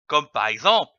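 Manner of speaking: ordinary delivery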